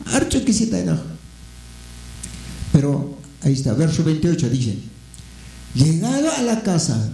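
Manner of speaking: ordinary talk, nothing marked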